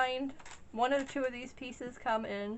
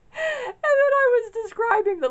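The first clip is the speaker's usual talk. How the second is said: laughing while telling; 12 dB louder than usual. Real, not acted. Embarrassing mix-up with shop owner.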